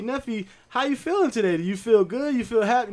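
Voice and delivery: high, coaxing teacher question. Retold or imitated. The teacher asks Neffy how she feels, high and coaxing. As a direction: sweetly prompt a small child. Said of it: "So she get to Neffy".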